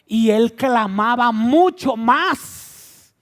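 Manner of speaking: loud, slow, swelling preaching; forceful stretched emphasis; emphatic crying out. A narrator's reading. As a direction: build to a drawn-out shout. Preacher raises voice to match clamor.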